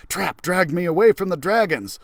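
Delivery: plain reading voice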